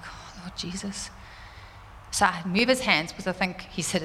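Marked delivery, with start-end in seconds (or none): 0.0-1.1 slow, much softer prayer under her breath; a mother's hushed plea over her hurt son. Retold or imitated. Mother sees injury, prays.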